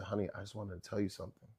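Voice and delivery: soft, hushed and quick; low-volume confidential murmur; confiding mother. Retold or imitated. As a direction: imitate a mother taking someone aside. Mother confides privately to son.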